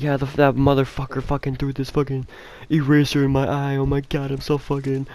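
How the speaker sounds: high, whiny imitation of a crying kid; mock crying. Bully telling the dean about the eraser, mock crying. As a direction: wail the complaint in a put-on high voice. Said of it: "He was all crying and shit".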